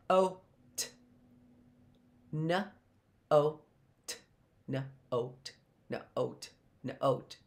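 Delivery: letter sounds kept apart, not yet blended; sounding out letters. Shown as a demonstration. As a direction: separate sounds of N, O, T with gaps. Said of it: "That's sounding out each letter".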